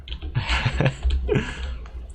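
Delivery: breathless wheeze of laughter; wheeze, out of breath from laughing; silent wheezing laugh. Real, not acted. Wheezing after laughing fit.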